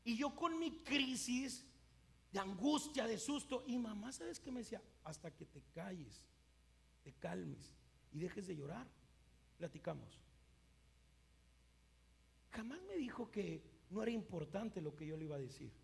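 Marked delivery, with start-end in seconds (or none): 5.0-10.2 imitated parent's measured hush; calm, firm mother. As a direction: quietly lay down terms to a crying child. Mother calming crying child, retold.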